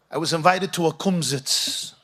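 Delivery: usual speaking voice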